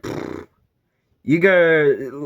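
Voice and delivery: dismissive cop. Retold or imitated. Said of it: "the cops are like".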